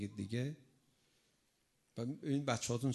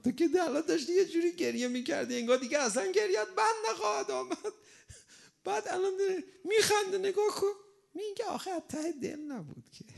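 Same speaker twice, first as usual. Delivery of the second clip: high, playful mock scolding; mother teasing her child who switched from crying to laughing, high and playful. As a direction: tease the child for faking tears.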